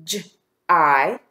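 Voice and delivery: "J-I" as a j sound, then long i; isolated sounds with a gap; tapping the sounds. Shown as a demonstration. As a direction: say "J-I" as two separate phonemes. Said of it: "Tap the sounds in the first syllable".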